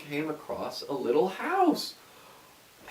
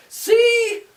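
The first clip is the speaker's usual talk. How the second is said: very high, loud witch squeak; 9 dB louder than usual. A narrator's reading. Witch sticks her head in the oven, very high and loud. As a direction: squawk it from inside the oven. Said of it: "she said".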